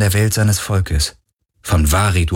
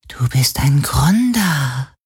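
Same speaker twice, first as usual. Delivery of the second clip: slow, unvoiced ghostly whisper; gewispert; 8 semitones higher, slower than usual. A narrator's reading. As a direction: whisper it like a secret. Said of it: "wisperte der Geist des Meeres". (leises Wispern)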